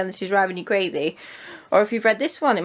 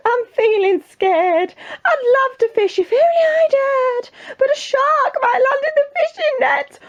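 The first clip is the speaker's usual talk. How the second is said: very high, drawn-out wail; scared cook; 6 dB louder, slower than usual. Acted. Cook afraid of fishing for the king, high panicky wail. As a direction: squeal it like a terrified storybook wimp.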